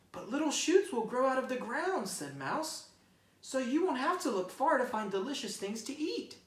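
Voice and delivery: high bright mouse voice; cheerful mouse. Acted. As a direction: point out the tasty side of spring.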